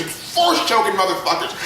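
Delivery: ordinary talk, nothing marked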